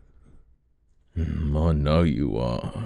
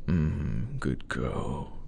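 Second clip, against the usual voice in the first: ordinary talk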